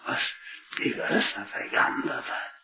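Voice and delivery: very low, soft, near-whispered savouring; quiet, husky-edged murmur of enjoyment; hushed relish. Acted. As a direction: drop almost to a whisper, as if savouring it. Savouring the mother's described pleasure.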